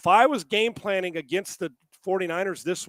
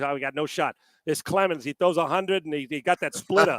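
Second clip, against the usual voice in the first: put-on East Coast accent; 3 semitones lower than usual. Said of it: "picture Reniak in that East Coast voice".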